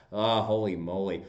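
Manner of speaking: normal talking voice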